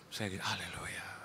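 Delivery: breathy aside whisper; hushed joking aside. Acted. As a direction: whisper it like a secret to the crowd.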